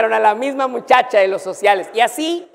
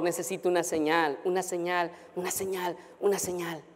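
fading plea; 10 dB quieter than usual. Retold or imitated. Repeated prayer fading away.

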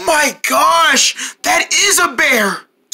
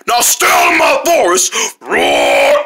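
bear roaring threat; 6 semitones lower than usual. Acted. Bear drives them off.

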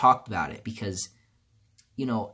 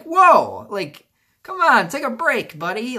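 higher, louder exasperated "whoa, come on"; half-joking, beg Death to back off; mock plea; 11 semitones higher, 8 dB louder, slower than usual. Telling Death to take a break, higher and louder in mock exasperation.

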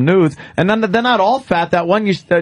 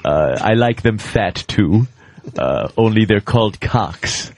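lower, measured put-on voice; mock-serious and deliberate; actor guest, politely disapproving; 9 semitones lower than usual. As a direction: play a ponderous, fussy actor giving his opinion. Plays interviewed actor who prefers men.

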